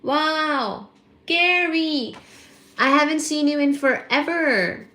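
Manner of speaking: high, loud, drawn-out excitement; overjoyed, calling out at high pitch; thrilled greeting. Real, not acted. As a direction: squeal hello to a long-missed friend. Greeting long-absent viewer.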